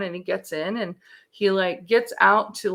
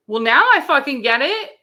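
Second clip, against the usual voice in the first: louder, higher, emphatic comeback; retold outburst of sudden understanding; exasperated retort; 6 semitones higher, 9 dB louder than usual. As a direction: throw it back at him, loud and pointed. Retold snap at husband about diagnosis.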